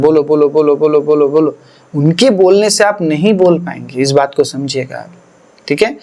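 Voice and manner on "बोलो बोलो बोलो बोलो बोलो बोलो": run-together, insistent repeated urging; imitated prodding. Church leaders prodding a newcomer to speak, mock insistent chant. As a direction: imitate someone pushing for speech, word after word. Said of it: "आपको वहाँ पे बोला जा रहा है".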